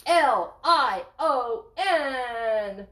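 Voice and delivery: L I O N spelled slow, letter by letter; spelling slowly. Shown as a demonstration. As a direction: spell it out for kids. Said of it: "What does it spell".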